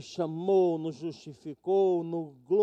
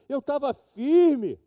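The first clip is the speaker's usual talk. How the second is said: slow and louder, insisting; proud firm claim; 6 semitones higher, 7 dB louder, slower than usual. Retold or imitated. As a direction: jovem afirmando com orgulho, devagar e mais alto. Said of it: "Ele falou".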